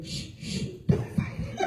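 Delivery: hushed unvoiced whisper, creepy; whispered menace. The cat Pedro whispering to Mister Brown on the couch. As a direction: whisper like a killer silencing a victim. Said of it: "I heard him say".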